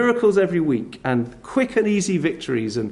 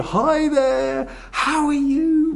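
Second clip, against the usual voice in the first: put-on high, slow, sing-song greeting to a tiny child; big bright hello to a toddler; preacher showing how adults greet small children, exaggerated sing-song; talking to toddlers; 11 semitones higher, much slower than usual. Shown as a demonstration. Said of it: "Big expressions, big smiles".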